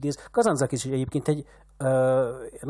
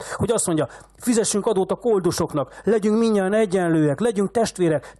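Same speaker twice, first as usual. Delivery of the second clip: put-on loud, much higher raging old man; frenzied anger; 5 semitones higher, 6 dB louder than usual. A narrator's reading. Old man raging at the shepherd's sermon, frenzied. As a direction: rant as if possessed by rage. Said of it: "olyan eszeveszett dühös".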